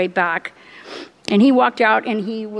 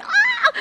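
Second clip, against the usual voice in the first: very high laughing shriek; a panicked cry that tips into a laugh; hysterical scream. Retold or imitated. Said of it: "I mean, I was hysterical".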